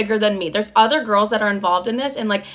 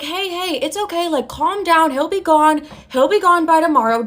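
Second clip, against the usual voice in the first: high-pitched mock reassurance, getting louder; hurried-to-please voice, pitched way up; placating boss impression; 6 semitones higher, 4 dB louder than usual. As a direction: imitate a flustered boss trying to calm someone.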